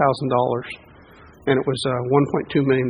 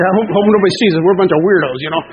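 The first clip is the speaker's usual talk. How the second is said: higher, louder comic worry; mock worry; 4 semitones higher, 6 dB louder than usual. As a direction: act out a self-conscious group praying in secret. Acts out embarrassed covert prayer group.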